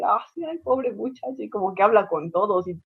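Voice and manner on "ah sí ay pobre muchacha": high, soft pitying murmur; mock chatty lady, lightly voiced; gossipy older lady. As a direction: cluck over the poor girl softly. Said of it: "Siempre deja así".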